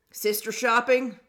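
imitating a stranger's voice, loud and drawn out; throat-clear, then a slow, loud question as a nosy old man; low man's voice. Stranger in line asks two women, imitated man's voice. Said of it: "we hear this low man's voice".

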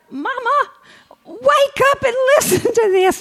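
high, loud call to wake mama; daughter calling. Retold or imitated. Daughter waking her sleeping mother, high loud call. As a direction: mimic the daughter rousing her. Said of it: "And she'd say".

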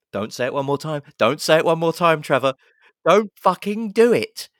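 rising, high, loud plea; mock frantic warning. Viewer begging Trevor not to say it, mock frantic warning. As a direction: build from urgent to hammed-up yell. Pleading with film character, building panic.

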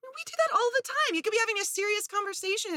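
incredulous that this needs saying; exasperated pitch-up. Real, not acted. Host exasperated explaining dog moments to listeners.

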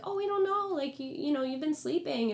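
high, put-on answer; high-pitched mock quote; mimicked cellmates.

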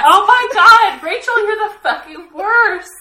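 high, loud laughing outrage; outraged shout. Real, not acted. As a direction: yell at the spoiler, laughing. Spoiled by friend, outraged.

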